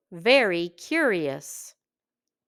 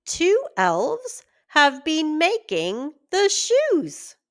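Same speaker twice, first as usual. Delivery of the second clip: higher, louder eager announcement; excited discovery; 8 semitones higher, 4 dB louder than usual. Acted. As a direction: tell the wife the big news. Shoemaker reveals the secret.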